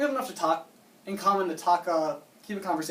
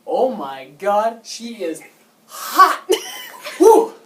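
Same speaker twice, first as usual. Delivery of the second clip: high, loud whoop of delight; excited; 3 semitones higher, 11 dB louder, much slower than usual. Real, not acted. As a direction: lose it over how hot she is. Reacting to hot new neighbor.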